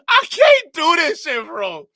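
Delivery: loud, high-pitched, overwhelmed cry; exasperated outburst. Real, not acted. Reacting in disbelief to a clip.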